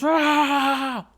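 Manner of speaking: long, high, loud shriek; sustained high wail; panicked scream. Acted. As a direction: scream back from down below. Onyx screams from the basement.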